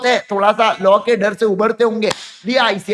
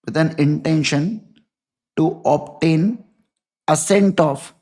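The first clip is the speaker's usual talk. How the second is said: stretched out phrase by phrase, lower; slow dictation; 5 semitones lower, much slower than usual. Shown as a demonstration. Teacher dictating the rule to students, slow and lower. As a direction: spell it out slowly for note-taking.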